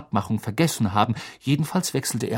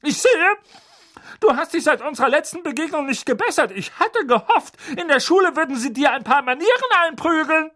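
scornful aunt. Acted. Aunt sneering at Harry's manners. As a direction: belittle the boy. Magda voiced in story.

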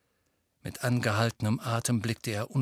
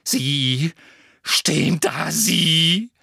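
louder, choking on the words; angry stammered yelling; 3 semitones higher, 7 dB louder, much slower than usual. Acted. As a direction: spit the accusation out. Harry still yelling.

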